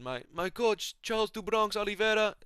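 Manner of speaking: high-pitched impression of a fighter's shout-out; imitated fighter. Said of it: "He said".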